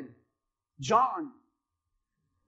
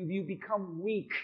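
ordinary delivery

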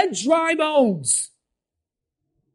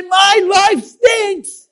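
slow lament; 6 semitones higher, 6 dB louder, much slower than usual. Retold or imitated. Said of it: "It's okay to be saved and say".

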